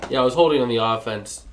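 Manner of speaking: usual speaking voice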